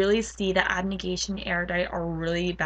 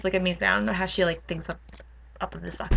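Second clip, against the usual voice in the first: ordinary talk, nothing marked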